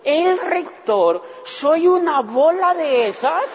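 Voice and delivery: dawning horror played for laughs; mock disbelief. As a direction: burst out laughing at your own foolishness. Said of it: "yo dije".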